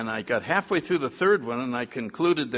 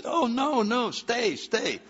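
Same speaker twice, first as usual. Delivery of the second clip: pleading; 10 semitones higher, slower than usual. Retold or imitated. Begging them to stay.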